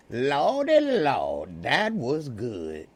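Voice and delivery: drawn-out, swooping Southern exclamation; Southern impression. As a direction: stretch it out with big Southern pitch swoops. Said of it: "when I was doing the A G".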